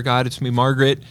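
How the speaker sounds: ordinary talk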